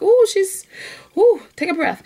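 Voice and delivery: high, loud gushing bursts; bubbly overexcited self. Retold or imitated. Mocking her own overexcitement to podcast listeners, high and loud. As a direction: gush like you can't contain yourself. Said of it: "I'm a little like".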